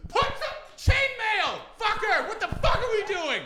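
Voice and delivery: loud, high screech; screaming at the TV. Retold or imitated. Screaming at his television, loud, high and furious. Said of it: "I'm screaming at my television".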